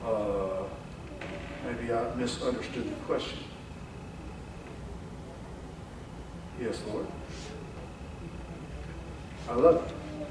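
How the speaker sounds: low, hushed, halting reply; hesitant Peter. Acted. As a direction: answer softly with long uncertain pauses. Acting Peter's hesitant reply to Jesus.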